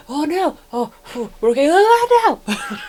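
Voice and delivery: hammed-up emotional whiplash; mock panic. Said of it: "I'm going".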